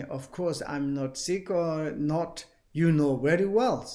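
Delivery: usual speaking voice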